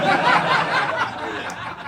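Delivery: loud high burst of laughter. Real, not acted. Laughing as he takes the money, loud. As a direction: crack up mid-sentence.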